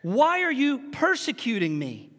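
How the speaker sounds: drawn-out, emphatic calling of the name; intense divine summons. Acted. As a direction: call him out slowly, like a parent's warning. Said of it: "it was meant to invoke intensity".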